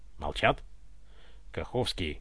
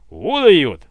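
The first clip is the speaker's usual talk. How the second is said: much louder burst of delight; admiring exclamation; 3 semitones higher, 11 dB louder than usual. A narrator's reading. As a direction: blurt it out in awe. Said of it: "восхитился Семёнов".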